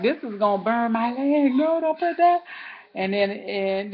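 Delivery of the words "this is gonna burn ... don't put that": high, loud panicked refusal; wife warning her husband off the hot rag, high; alarmed protest. Retold or imitated. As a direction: stop him before it burns. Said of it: "I'm like".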